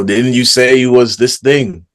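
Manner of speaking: mock loud challenge; ringing voice. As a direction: imitate a man's voice ringing across the group. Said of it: "this man's voice is ringing".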